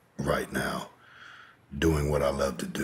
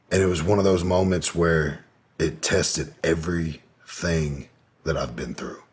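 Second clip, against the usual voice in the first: ordinary talk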